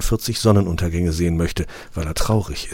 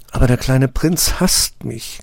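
lamenting; 6 semitones higher than usual. A narrator's reading. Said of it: "klagt sie".

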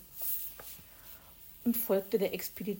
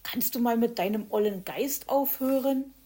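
irritated; 4 semitones higher, 7 dB louder than usual. Acted. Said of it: "war Hoppel gereizt".

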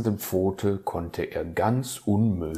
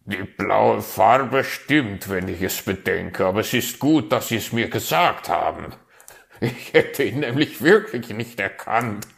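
teasing raven; 3 semitones higher, 7 dB louder than usual. Acted. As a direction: poke fun at the botched figure. Raven teases about the figure.

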